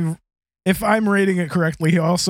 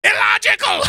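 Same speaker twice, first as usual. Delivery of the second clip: mock scream; 13 semitones higher, 3 dB louder than usual. Repeating Sarek's scream.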